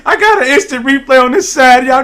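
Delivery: words squeezed out through real laughter; high, loud, laughing helplessly at the replay; laughing hard. Real, not acted. Streamer to viewers, cracking up at his replay, laughing. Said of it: "see why I'm laughing so hard".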